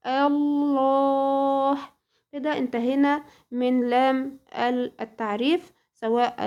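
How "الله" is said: الله drawn out very slowly with a thick, heavy lam; heavy lam in Allah. Shown as a demonstration. Teacher showing student the heavy lam, slow recitation. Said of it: "فاللفظ هنا هيبقى مغلظ".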